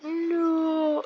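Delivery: high wailing no; hammed-up tearful no; anguished no. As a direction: cry it out like a wounded hero. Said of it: "And Steve is like".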